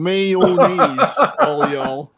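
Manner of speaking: loud mock Texas accent; playful attempt at Texas talk. Shown as a demonstration. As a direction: ham up a Texas accent, loudly. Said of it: "better at doing a Texas accent".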